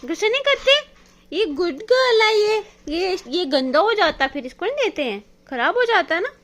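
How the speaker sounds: high, loud gentle telling-off of the pet; high pet-talk scolding; talking to a dog. Real, not acted.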